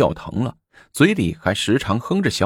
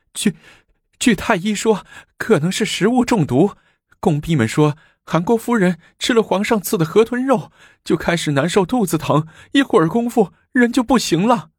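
much higher, anxious servant voice; frightened report; 13 semitones higher than usual. Acted. Servant explaining the poisoning to the emperor, frightened. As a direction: report it trembling with fear.

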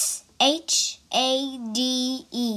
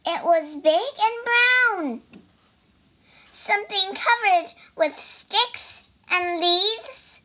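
higher, louder little mouse voice; frightened mouse; 7 semitones higher, 3 dB louder than usual. Acted. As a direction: tell it, wide-eyed and scared. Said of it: "cried the mouse".

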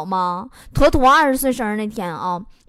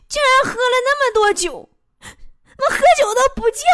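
mock drunken wailing; 10 semitones higher than usual. Drunk girlfriend wails on phone.

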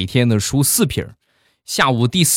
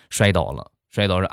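plain reading voice

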